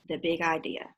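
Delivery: plain reading voice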